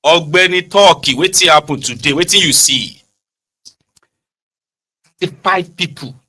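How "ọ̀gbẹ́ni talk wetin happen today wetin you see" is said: loud insistent calling to a man, pitched up; calling out loudly. Acted. Pressing a man for news, loud call. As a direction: shout across to him, demanding the news.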